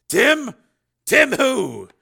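loud, high puzzled questions; sharp, insistent query; urgent questioning. A narrator's reading. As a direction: press him for the full name. Father mishears, presses for name.

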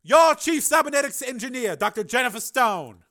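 high, loud, commanding senator; Carmen, senator's voice. Acted. Senator announcing the engineer's name to the committee, commanding. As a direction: announce it with cold authority.

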